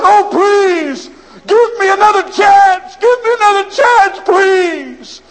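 high, loud wailing plea; desperate crying plea. Acted. Condemned man wailing to the governor for another chance. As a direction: beg through tears for mercy. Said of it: "shook the bars and cried".

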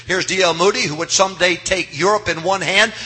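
ordinary talk, nothing marked

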